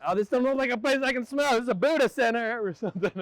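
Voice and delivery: very high mimicking voice; hammed-up high-pitched mock voice; bad impersonation. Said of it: "that was the worst impersonation".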